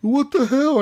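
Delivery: high-pitched mock bewilderment; imitating outraged confusion; imitated indignant protest. Retold protest of rammed players.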